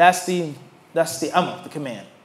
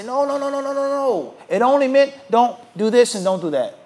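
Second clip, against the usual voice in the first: higher, fast denial, a touch mocking; protesting man; 4 semitones higher than usual. Accused man denying to the judge, higher. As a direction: wriggle out of it with a frantic no.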